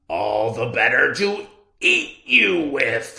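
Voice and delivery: loud menacing wolf; wolf's triumphant line. Acted. Wolf springing on the girl, loud menacing roar. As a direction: bellow it as you pounce.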